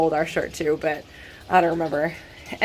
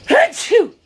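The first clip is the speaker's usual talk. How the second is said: loud, high achoo sneeze; real sneeze; 7 dB louder than usual. Real, not acted. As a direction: let out a big sneeze. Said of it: "I have to sneeze".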